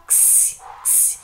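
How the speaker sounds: "ks" sounded out alone, repeated after a pause; isolated syllable sound. Shown as a demonstration. Teacher isolating the strongest syllable, sounded out alone. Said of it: "a sílaba mais forte é o".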